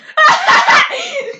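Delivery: high burst of loud laughter. Real, not acted. Sister cracks up at the painting story, loud high laugh. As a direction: cracks up loudly, high and bright.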